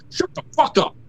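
fed up, letting it all out, pitched high; furious outburst. Real, not acted. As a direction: blow up at the whiners. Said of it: "Breathe. Breathe".

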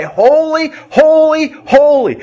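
a lightly voiced angel chorus proclaiming; angelic cry of holy.

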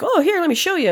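a hint of an eager roommate, said high; imitating roommate. Roommate offering to show the video, mock high voice. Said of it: "And he was like".

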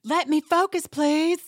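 put-on high, tense kid pleading; whiny kid. Sutton snapping at her assistant, high kid whine. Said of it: "reacted like a kid would".

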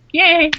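small, high-pitched "yay"; tiny mock cheer. Mimicking the few English passengers at the back, tiny high mock cheer. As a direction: imitate a meek little cheer from the back. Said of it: "a tiny little clap at the back".

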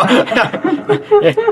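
high, delighted laugh. Real, not acted. Host cracks up at own moving joke, laughing.

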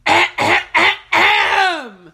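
loud pointed ahem; pointed throat-clear. Retold or imitated. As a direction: clear your throat to get attention. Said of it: "you finally go".

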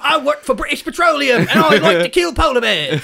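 high-pitched put-on British voice; hammed-up British oil man; cartoon BP executive. Executive bragging about killing bears, caricature British voice.

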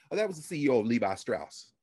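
usual speaking voice

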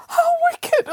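high wailing sob, voice breaking; sobbing. Acted. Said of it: "sobbed Boris".

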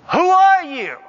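loud, high-pitched challenge; booming demand. Acted. God demands that Jacob confess his name, booming demand. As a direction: thunder the question like God confronting a man.

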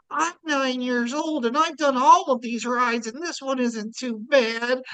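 put-on high, slow little-kid voice; cute little kid. Cute kid reassuring a scared boy on the ride, little-kid voice. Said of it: "this cute little kid next to him who's like".

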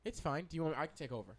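usual speaking voice

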